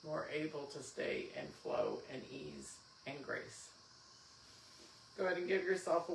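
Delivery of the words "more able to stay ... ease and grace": guided meditation. Acted. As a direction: guide them gently into stillness. Leads final relaxation.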